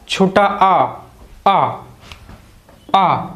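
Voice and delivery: usual speaking voice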